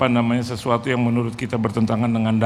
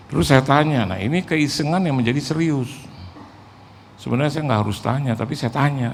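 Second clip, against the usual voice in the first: ordinary talk, nothing marked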